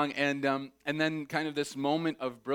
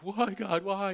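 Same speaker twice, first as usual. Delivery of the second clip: lamento fingido; 7 semitones higher, slower than usual. Retold or imitated. Mock despair of a child.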